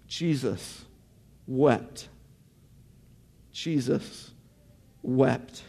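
low, soft, with a pause between the words; hushed solemnity. A narrator's reading. Preacher reads the shortest verse to the congregation, hushed solemnity. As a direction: let each word fall quietly and stand alone.

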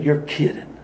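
quiet unvoiced disbelief; stunned whisper. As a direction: breathe it out in shock. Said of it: "The manager said".

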